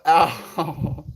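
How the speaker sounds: mock pained yelp. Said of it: "I go".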